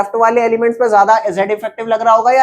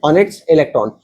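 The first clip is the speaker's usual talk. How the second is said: every word spelled out slowly for students to copy; slow dictation; 6 semitones lower, much slower than usual. Acted. Teacher dictating the definition to students, very slow. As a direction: dictate it word by word so they can write.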